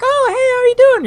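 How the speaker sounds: very high, loud mock-friendly sing-out; fake perky greeting. Mimicking people pressured into being happy, fake perky. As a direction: squeal it like a forced customer-service hello. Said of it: "I don't even fucking talk like that".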